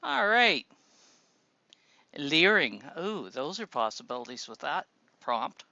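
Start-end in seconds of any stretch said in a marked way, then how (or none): none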